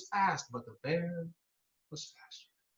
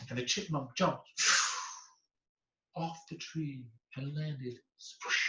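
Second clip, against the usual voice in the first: ordinary delivery, nothing marked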